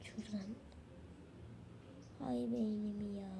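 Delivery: hushed, low muttering while cheating; sneaky whisper. Acted. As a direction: whisper so the teacher won't hear. Cheating student whispering to himself. (kopya çeken öğrencinin fısıltısı)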